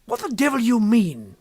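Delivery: sharp, loud, indignant cry; angry outburst. Acted. Kennedy crying out angrily at Berger's question. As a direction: snap back in outrage. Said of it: "he cried".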